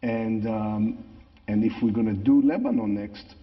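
English with a natural Israeli accent. Real, not acted. Same speaker continues.

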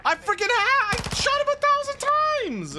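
very high, squealed protest; real frustrated squeal at the game; exasperated yell. Real, not acted. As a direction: cry out in high-pitched outrage.

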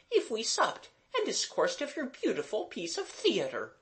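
very high, bright governess voice; Mademoiselle. A narrator's reading. Governess proposing supper to the children, brightly. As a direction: chirp it brightly. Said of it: "said Mademoiselle brightly".

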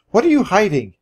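high-pitched demand; exasperated mild shriek. A narrator's reading. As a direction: demand it, out of patience. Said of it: "I shrieked at last".

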